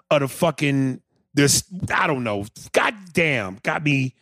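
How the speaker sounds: usual speaking voice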